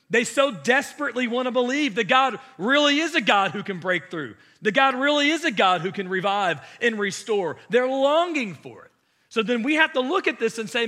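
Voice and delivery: loud, high preaching at full force; fervent preaching. Acted. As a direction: preach it out, rising with passion.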